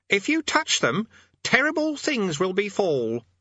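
put-on high, insistent boy's voice; threatening boy. Boy warning his demon.

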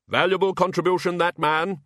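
high, loud, officer-like praise; blustering Archchancellor. Acted. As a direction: dismiss him with booming mock approval. Archchancellor gives up on Dean.

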